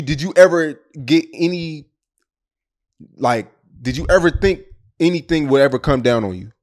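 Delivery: ordinary talk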